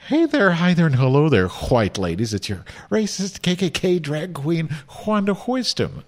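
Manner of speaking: mock Wanda greeting. Drag guest mocks the host's show opening, high and singsong. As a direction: send up the host's welcome. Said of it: "If I was racist Wanda, I would have said".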